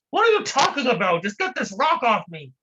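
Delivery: high and loud, fed up; trapped man, impatient. Acted. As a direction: brush off the lecture, just get me out.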